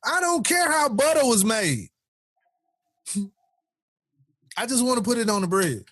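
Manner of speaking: high-pitched comic protest; high, exasperated retort; mock exasperation. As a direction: pitch it up, fed up with the details. Acted retort to a know-it-all.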